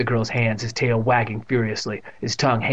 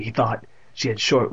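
plain reading voice

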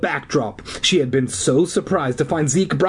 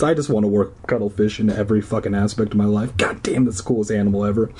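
fast muttered aside; 3 semitones lower, faster than usual. Real, not acted. Reader's fast low aside.

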